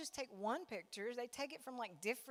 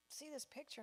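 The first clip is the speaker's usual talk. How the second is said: usual speaking voice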